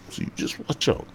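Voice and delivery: ordinary talk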